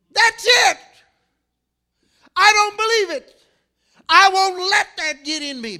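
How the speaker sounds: high, loud outburst of refusal; acted full-voiced protest; defiant shout. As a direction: shout it out in stubborn disbelief. Acting out resistance to God's promise.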